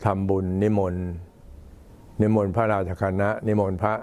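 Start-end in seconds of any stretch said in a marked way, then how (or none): none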